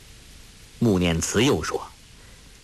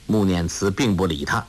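usual speaking voice

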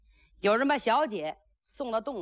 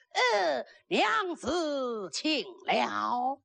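repeated courtly greeting; 3 semitones higher, much slower than usual. Acted. Flustered groom repeating his greeting to the bride, drawn-out courtesy. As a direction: greet her formally, pitched up then settling. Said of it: "怎么又重复一句呢".

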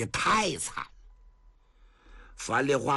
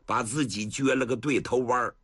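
usual speaking voice

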